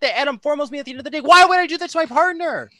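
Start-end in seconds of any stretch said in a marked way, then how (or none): 1.2-2.7 raised, higher-pitched indignant question; indignant protest. Real, not acted. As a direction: protest your innocence, pitch climbing. Indignant self-defence in a heated game.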